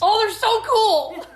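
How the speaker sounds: high, loud gush with a laugh; excited squeal. Real, not acted. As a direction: squeal with delight at the glasses. Gushing over new glasses.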